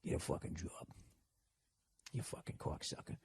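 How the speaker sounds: barely voiced, very low mumble; imitating a muttering cubicle worker; mumbling. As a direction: mumble it privately under your breath. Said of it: "just mumbling".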